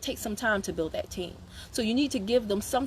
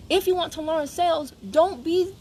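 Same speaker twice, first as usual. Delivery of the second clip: ordinary delivery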